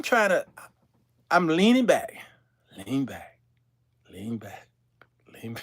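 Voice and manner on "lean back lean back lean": low hushed whisper. Retold or imitated. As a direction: whisper it, shrinking away. Leaning away from stench.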